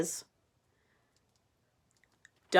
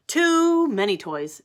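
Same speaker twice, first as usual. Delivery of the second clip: slow, louder fussy complaint; fussy pigeon; 5 semitones higher, 8 dB louder, slower than usual. Acted. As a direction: stretch out the whine. Pigeon complaining about bath.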